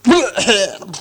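sputtering choke on a drink; troll choking on his beer; choking cough. Acted. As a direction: cough as if beer went down wrong. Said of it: "William choked".

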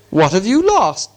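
persistent. A narrator's reading. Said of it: "Bilbo persisted".

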